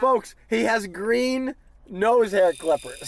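announcing the green clippers to the audience, slow and loud; mock announcer. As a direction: present it like a big reveal, slowly.

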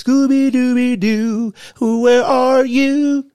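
high, loud, slow sing-song calling; mock the defense with a cartoon call; cartoon Scooby call.

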